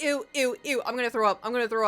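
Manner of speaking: hammed-up gagging friend; imitating Kiwi grossed out. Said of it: "And Kiwi's like".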